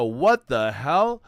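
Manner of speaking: startled zebra. Acted. Zebra seeing the hunters still coming, startled and loud. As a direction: drag it out, alarmed. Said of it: "And they go".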